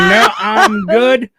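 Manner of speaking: giddy no-thanks; laughing refusal. Retold or imitated. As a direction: wave off another hit while still laughing. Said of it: "I'm like".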